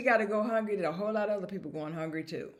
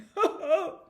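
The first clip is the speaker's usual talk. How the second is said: sudden high laugh; high burst of laughter; 9 semitones higher, 5 dB louder than usual. Real, not acted. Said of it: "Ooh, I'm t-, crying".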